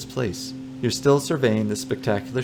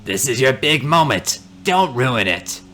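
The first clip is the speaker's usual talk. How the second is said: put-on stern old man; 3 semitones higher, 5 dB louder than usual.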